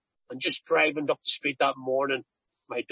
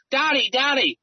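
put-on child calling out; 8 semitones higher, 4 dB louder than usual. Daughter calls out in street.

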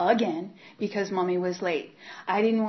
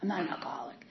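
whispered denial; 6 dB quieter, faster than usual. Retold or imitated.

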